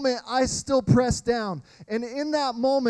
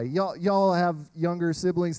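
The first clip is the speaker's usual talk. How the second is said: ordinary delivery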